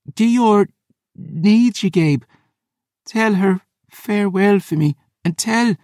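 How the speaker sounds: high, strained dying woman; pained snarl. Acted. Dying Phoebe gives farewell wishes, pained and straining. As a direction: force out last wishes through agony. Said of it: "she snarled".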